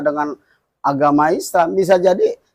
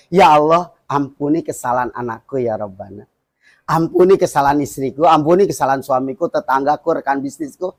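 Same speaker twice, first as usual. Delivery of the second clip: humble prayer; 4 semitones lower than usual. Shown as a demonstration. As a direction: pray it humbly, asking forgiveness for family. Teaches a model prayer.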